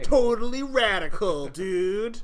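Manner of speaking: Totally radical dude, loud and high, stretched slow; extreme hyped kids ad. Acted. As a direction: hype it like a surfer in a toy commercial. Jokes on AVR dude name.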